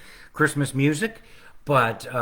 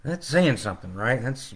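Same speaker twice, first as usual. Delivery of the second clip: ordinary delivery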